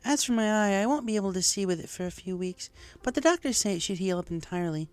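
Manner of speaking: high mare voice, composed; Lyra, calm unicorn. Acted. Lyra in hospital telling Rainbow about her eye, high calm voice. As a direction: brush off the injury, then welcome her.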